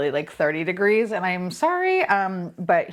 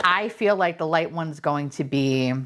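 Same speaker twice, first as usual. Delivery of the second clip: normal talking voice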